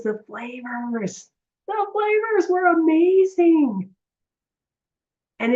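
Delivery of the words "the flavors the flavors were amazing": linger, then burst into delight; rapturous. Retold or imitated. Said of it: "She goes".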